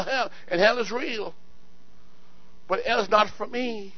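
normal talking voice

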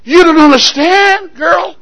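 high, loud anguished protest; crying father. Acted. Preacher as father crying to his daughter, anguished. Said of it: "he started crying".

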